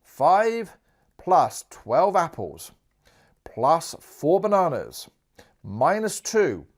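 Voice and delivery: reads each term out slowly and firmly; slow, loud read-out. Shown as a demonstration. Teacher reading the sum aloud to the class, slow and deliberate. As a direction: spell out the sum word by word. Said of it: "I'm just gonna say this out loud".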